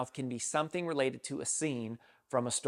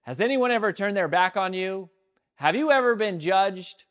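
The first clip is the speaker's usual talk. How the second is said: raised high and loud, calling out to the hall; dramatic opening question; 6 semitones higher, 7 dB louder than usual. Acted. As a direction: boom a dramatic opener from the stage with your back to the audience. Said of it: "I said".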